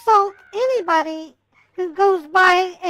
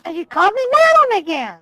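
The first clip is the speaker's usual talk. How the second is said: fast, raised and angry; loud sharp voice; 4 semitones higher, much faster than usual. Real, not acted. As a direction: demand it impatiently. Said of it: "why are you yelling at me".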